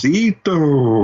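high, drawn-out name call; mock taunting call. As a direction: imitate a taunt, stretching the name out. Co-host mimics the character's taunting call.

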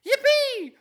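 put-on shrill, very high loud kid cheer; Anakin yippee. Anakin cheering as a child, loud and very high. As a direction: squeal it like an excited little boy. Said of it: "six-year-old Anakin ... going".

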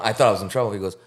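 ordinary talk, nothing marked

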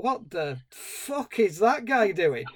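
drawn-out, baffled disbelief, swooping in pitch; incredulous editors. Retold or imitated. Editors spotting the odd dancer in the footage, incredulous. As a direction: act out a slow-dawning, appalled question. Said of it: "they probably thought".

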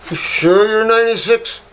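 high-pitched, incredulous question; retold disbelief. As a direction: imitate your own amazed double-check. Retold disbelief at her age.

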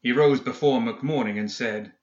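plain reading voice

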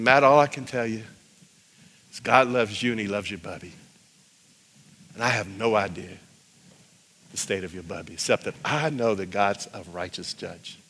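soft, low, measured compassion; gentle reassurance. Retold or imitated. Evangelist answering a hurt student about his grandmother, gentle reassurance.

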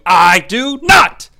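slow, emphatic denial, each word punched; mock indignant denial. Peter denying that he knows Yeshua, hammed-up emphatic denial. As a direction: stretch the I and hit NOT hard.

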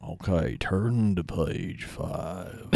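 mock deep soothing trainer. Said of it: "that guy's like".

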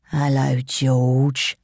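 put-on low, slow menacing young man. Young henchman greeting his hidden victim, menacing. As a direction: greet slowly with a horrible edge. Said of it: "said a younger voice, with a horribly menacing edge".

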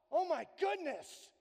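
high, loud panicked exclamation; mock freaking out. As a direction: overreact in alarm. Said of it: "freaking out".

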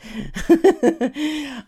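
bright delighted burst of laughter; happy laugh. Real, not acted. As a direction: laugh out with joy. Laughs at bestseller news.

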